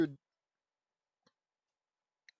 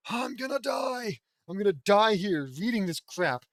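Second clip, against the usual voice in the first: exasperated outburst; 5 semitones higher, 6 dB louder than usual. Real, not acted. Reader fed up with the fanfic, high exasperated yell. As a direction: yell like reading this is killing you.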